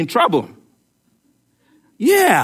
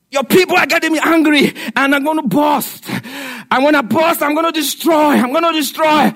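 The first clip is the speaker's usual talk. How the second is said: hammed-up angry God; 6 semitones higher than usual. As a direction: play God about to burst with anger. God threatens to burst.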